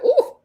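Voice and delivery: high, loud admiring ooh; mock ooh. Vlogger admiring the feather outfit, high and loud. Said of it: "I said".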